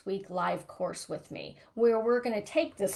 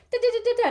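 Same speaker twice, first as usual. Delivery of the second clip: mock mind chatter; 14 semitones higher, 8 dB louder, much faster than usual. Mocking her own inner chatter.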